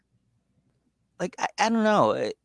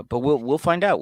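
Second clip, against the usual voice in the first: ordinary talk, nothing marked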